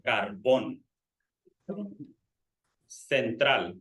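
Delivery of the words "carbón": Carbón with the last syllable stressed, bón. Shown as a demonstration. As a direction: say Carbón leaning on the final O. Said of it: "we pronounce the O a little stronger".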